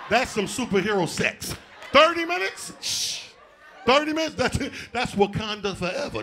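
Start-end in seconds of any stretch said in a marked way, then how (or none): 1.9-4.4 high, loud rising "Thirty minutes?"; awed. Acted. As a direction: gasp it out impressed. Mock awe.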